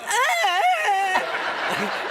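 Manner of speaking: very high, loud comic wail; mock pained scream. Crash victim flailing in bed next day, mock screaming. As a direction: shriek helplessly, hammed up.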